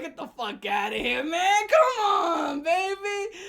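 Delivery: builds to a loud high taunt; mocking shout. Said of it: "I was like".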